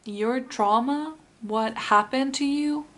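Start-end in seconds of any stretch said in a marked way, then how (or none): none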